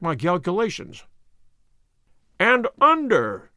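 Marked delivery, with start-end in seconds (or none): 2.4-3.5 loud, high, drawn-out exclamation; excited cry. A narrator's reading. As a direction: cry it out, each word stretched and louder. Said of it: "he cried".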